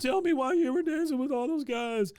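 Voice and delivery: high, loud pleading whine; mock bawling. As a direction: plead like a jilted boy, hammed up. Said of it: "he is just balling at this point".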